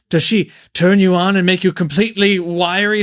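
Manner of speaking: lightly voiced creepy host, pushing too far; a hint of a leering stranger; creepy Mister Baynard. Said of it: "starts saying really weird comments like".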